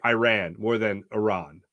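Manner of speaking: first "Iran" eye-RAN, second "Iran" ih-RAHN; contrasting pronunciations. Shown as a demonstration. Host explaining American accents, Iran as eye-RAN then ih-RAHN. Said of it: "our accent tends to".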